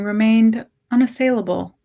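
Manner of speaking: usual speaking voice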